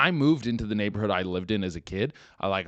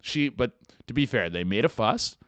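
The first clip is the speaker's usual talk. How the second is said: usual speaking voice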